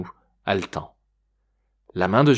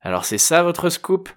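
high, irritated challenge; getting angry; 8 semitones higher than usual. A narrator's reading. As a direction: snap at the fake scoop. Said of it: "s'énerva l'homme". (agacement montant, voix aiguë)

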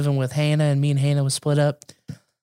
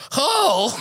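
shocked oh; 9 semitones higher than usual. Retold or imitated. Gasped discovery.

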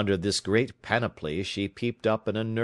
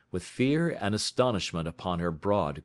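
plain reading voice

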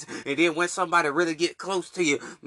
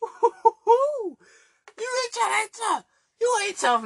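laughing; much slower than usual. Real, not acted. Laughing at tough guys online.

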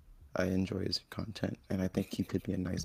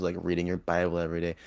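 ordinary delivery

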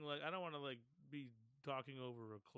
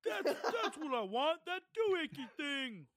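laughing; 11 semitones higher, 11 dB louder than usual. Pretend. Laughing through mock customer line.

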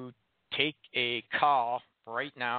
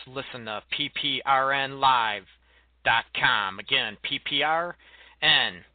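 louder, punchy station promo; radio promo read; 4 dB louder than usual. Acted. Host plugging the radio network, loud punchy promo. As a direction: sell the network like an announcer.

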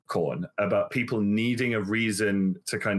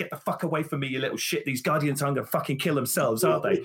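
mock snapping at kids; 6 semitones higher, faster than usual. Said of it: "And I'm like".

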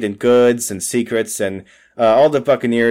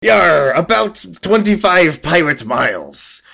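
pirate voice, pitched up; 4 semitones higher, slower than usual. Acted. Pirate telling crewmates the sailing distance, playful pirate voice. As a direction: answer with a hearty "yar" pirate act. Playing a pirate answering crew.

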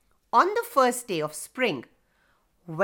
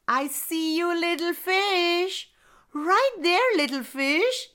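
put-on little boy voice; 7 semitones higher than usual. Boy calls to fish.

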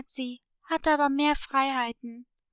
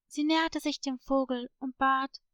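ordinary delivery, nothing marked

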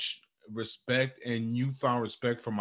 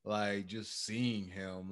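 soft tender; 2 semitones lower, 7 dB quieter than usual. Real, not acted. Tender about his son.

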